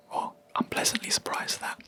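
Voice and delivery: normal talking voice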